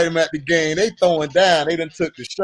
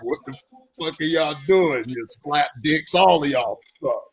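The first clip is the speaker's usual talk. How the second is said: low, softer gruff coach; angry coach; 7 semitones lower, 4 dB quieter than usual. Retold or imitated. Coach cussing out the players, low and gruff. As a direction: growl it low like a fed-up coach. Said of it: "who just come out there and be like".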